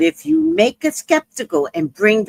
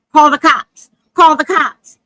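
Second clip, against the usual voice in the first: higher and louder, insistent; urgent order; 6 semitones higher, 7 dB louder than usual. Retold or imitated. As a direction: repeat it like an urgent command. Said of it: "she comes up to me and she goes".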